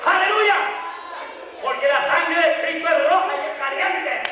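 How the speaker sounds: high, driving pulpit shout; impassioned high-pitched sermon cry; full-force preaching. Real, not acted. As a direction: drive each phrase home at a shout.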